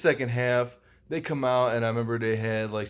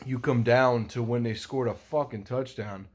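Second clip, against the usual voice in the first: ordinary delivery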